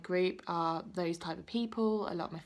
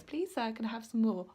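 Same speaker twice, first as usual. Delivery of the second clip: a light, pleading Oliver Twist voice, a little higher; Oliver Twist begging; 3 semitones higher than usual. Retold or imitated. A vlogger playfully quoting Oliver Twist asking for more, mock pleading. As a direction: beg like the orphan boy asking for more. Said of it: "when he goes up and he says".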